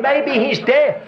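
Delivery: mocking jibe. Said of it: "Or he said".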